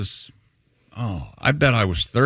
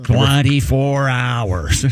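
very slow, drawn-out sign reading; William Hickey; 4 dB louder, much slower than usual. Retold or imitated. Movie passenger reading billboards aloud, laboriously slow imitation. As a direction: stretch each word as if sounding out a billboard. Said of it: "talks like this in the movie".

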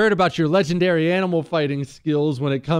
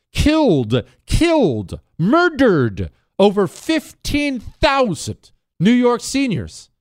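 louder, slower and higher, hammering each word; outraged emphasis; 7 semitones higher, 6 dB louder, slower than usual. Real, not acted. Host to listeners over Cuomo's nursing home deaths, outraged loud emphasis. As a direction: spit out the words in disbelief.

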